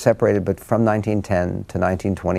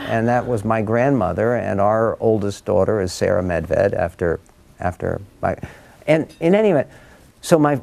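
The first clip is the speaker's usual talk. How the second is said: ordinary talk, nothing marked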